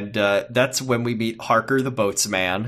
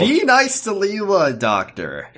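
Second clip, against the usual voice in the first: mock plea; 8 semitones higher, slower than usual. Teasing plea to the Doctor.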